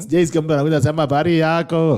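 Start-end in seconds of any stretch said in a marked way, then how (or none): 1.0-2.0 lightly put-on Indian accent; mock Indian accent. Host joking about Kenyans returning from India, mock Indian accent. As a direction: imitate someone back from India. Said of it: "Wakenya wengi wameenda India".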